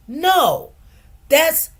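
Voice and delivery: usual speaking voice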